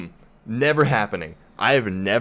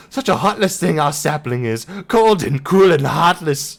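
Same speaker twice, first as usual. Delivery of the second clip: louder mock Irish-Scottish brogue with giggles breaking through; second go at the Irish brogue, louder; attempted Irish accent; 8 semitones higher, 5 dB louder than usual. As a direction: ham up the fey accent, half laughing. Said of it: "but I will try my absolute best to pull this off".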